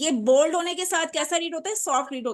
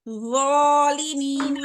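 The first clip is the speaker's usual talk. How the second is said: slow model reading; 3 dB louder, much slower than usual. Shown as a demonstration. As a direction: stretch every syllable of ज़ालिमीना slowly for the student. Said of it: "then ये raw read होता है". (बहुत धीमे खींचकर ज़ालिमीना)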